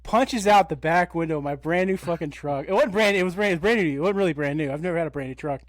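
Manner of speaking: usual speaking voice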